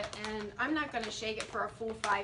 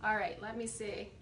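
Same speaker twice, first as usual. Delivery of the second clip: usual speaking voice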